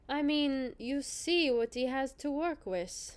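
slow German movie villain accent. Shown as a demonstration. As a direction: deliver it like a German Batman villain. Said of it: "Can you do a German accent for this one?".

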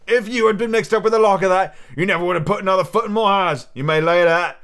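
loud, high, angry landlord; blustering pirate warning; exclaiming. Acted. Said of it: "exclaimed the landlord".

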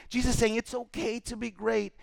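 normal talking voice

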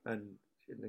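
usual speaking voice

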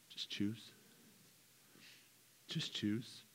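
hushed voice of God granting a blessing; gentle God voice. Pretend. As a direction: let God answer softly and kindly.

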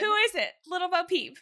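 high singsong question; playful teasing voice. Pretend. Host teases the next host, silly high voice.

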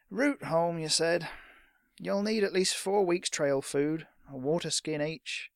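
grudgingly helpful merchant; resigned shopkeeper. Acted. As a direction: reckon up the order slowly. Shopkeeper gives in, lists goods.

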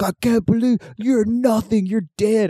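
high, drawn-out mock threat; imitated drunken yelling. Drunk man threatening his brother, hammed-up yelling. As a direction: wail it slowly like an outraged drunk. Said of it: "they just started yelling at each other".

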